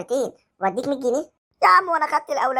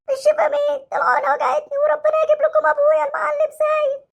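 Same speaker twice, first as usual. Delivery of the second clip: put-on much higher, whiny child's voice; hurt boy threatening the doctor with his dad; sulky hurt child; 10 semitones higher than usual. Child voice crying after injection.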